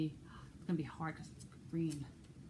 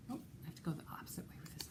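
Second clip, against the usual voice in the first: quick whisper to herself; hushed aside while fumbling the book; 10 dB quieter, much faster than usual. Real, not acted. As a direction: mutter it under your breath, quickly.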